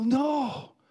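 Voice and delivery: mock yell to stop her; re-enacted yell. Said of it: "I wanted to yell".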